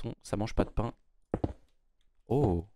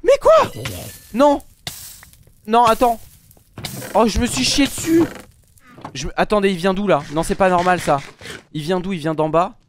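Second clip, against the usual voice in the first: panicked shrieks after a jump scare; high, loud terrified yelling; scared horror game streamer; 8 semitones higher, 9 dB louder than usual. Real, not acted.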